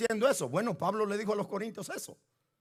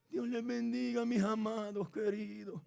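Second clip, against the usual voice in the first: mock timid preaching; 7 dB quieter, slower than usual. Mocking timid microphone preaching.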